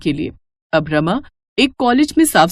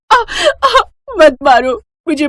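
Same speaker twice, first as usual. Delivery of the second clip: high, louder sobbing cry for mercy; crying plea; 10 semitones higher, 3 dB louder than usual. Acted. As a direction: beg him to stop through tears. Wife beaten by drunk husband.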